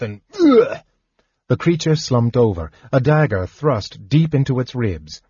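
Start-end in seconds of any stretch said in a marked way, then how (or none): 0.0-0.8 stabbed grunt cry. A narrator's reading. Guard stabbed mid-sentence.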